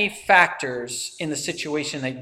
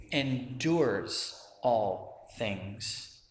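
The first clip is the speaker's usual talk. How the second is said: very slow scripture reading, phrase by phrase; much slower than usual. Real, not acted. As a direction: draw out each phrase and leave long pauses. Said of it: "I'm gonna read it slowly".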